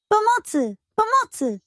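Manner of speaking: high, panicked calls for help; tiny chocolate folk crying out in fear; frightened cry for help. Acted. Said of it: "wołały jednym głosem". (przerażone, wysokie wołanie o pomoc)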